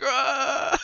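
mock cry of hanging on; imitated strained scream. Imitating a movie character holding the door against a tornado, straining yell. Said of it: "he's holding the, uh, the door like".

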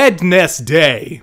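"Wednesday" slowly as wed-ness-day; spelled-out Wednesday. Shown as a demonstration. Ranting about weekday spellings, "Wednesday" slowly sounded as wed-ness-day. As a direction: say "Wednesday" with every syllable sounded.

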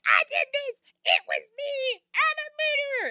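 very high-pitched wailing confession; horror movie scream. Acted. Ruby confessing to a murder, hammed-up horror movie scream. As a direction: shriek it out like a hysterical horror heroine. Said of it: "horror movie scream".

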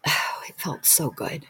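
sighing, hushed relief; soft whispered relief; relieved whisper. Real, not acted. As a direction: breathe it out softly as relief. Relief after release.

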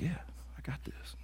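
breathy confident whisper, sure of himself; cocky whisper. Retold or imitated. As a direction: whisper it like you've got this. Confident reply to wife, whispered.

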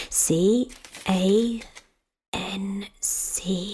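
filling in crossword letters in a whisper; whispered to self. Real, not acted. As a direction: whisper the letters as you fill them in.

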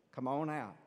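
ordinary delivery

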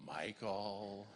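low, hushed reproach; quiet, disappointed name-call; reproachful wife. Retold or imitated. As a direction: imitate a dismayed wife, very softly. Wife reproaching him after his yell.

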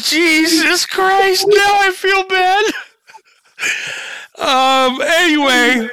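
high, loud laughing; can barely talk for laughing. Real, not acted. Still laughing at dark joke.

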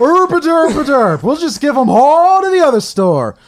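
high, loud dopey mocking voice; mock dumb clerk. Mocking the store staff who gave tickets away. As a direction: play the store staff as total idiots.

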